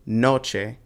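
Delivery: noche as NO-che; stress on first syllable. Shown as a demonstration. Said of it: "you put the stress on the first one".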